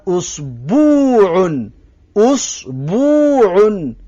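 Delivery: اسبوعون slowly with a long u in the middle; added long u. Shown as a demonstration.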